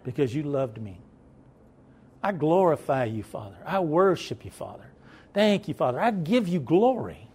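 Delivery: a lightly voiced, earnest prayer to the Father; sample prayer. Shown as a demonstration. Preacher showing the church how to pray, lightly voiced as a prayer. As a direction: model a simple heartfelt prayer, slightly hushed. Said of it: "Tell God".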